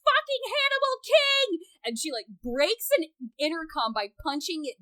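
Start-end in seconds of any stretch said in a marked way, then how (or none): 0.0-1.5 very high, loud mock scream; hammed-up villainess screech; imitated scream. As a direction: shriek the name in theatrical fury. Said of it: "she just screams".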